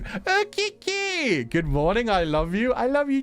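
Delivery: high, drawn-out delighted name call; thrilled, stretched-out hello; excited greeting. Real, not acted. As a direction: squeal the name in welcome. Greets friend arriving in chat.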